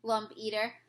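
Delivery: ordinary delivery, nothing marked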